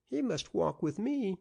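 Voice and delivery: put-on high, eager little boy's chirp; bright child insisting on his game. A narrator's reading. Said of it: "chirped Hamilton".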